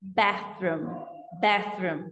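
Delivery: "Bathroom" with tongue-tip th, low; correct th. Shown as a demonstration. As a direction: model the th in "Bathroom". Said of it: "Linguinha no vão do dente". (língua entre os dentes)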